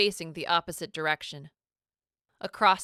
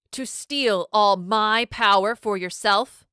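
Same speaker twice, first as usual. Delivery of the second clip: angry accusation; 3 semitones higher, 7 dB louder than usual. A narrator's reading. Villain accuses captive.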